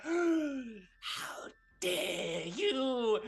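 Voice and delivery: high, hugely drawn-out outrage; affronted villain. Acted. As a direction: gasp it out slowly, word by word. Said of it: "And he's like".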